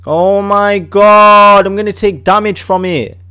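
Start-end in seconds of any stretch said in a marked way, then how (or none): none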